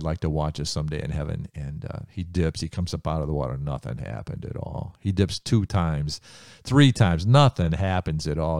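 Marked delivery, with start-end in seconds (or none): none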